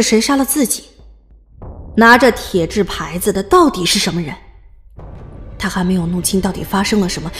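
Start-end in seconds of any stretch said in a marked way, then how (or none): none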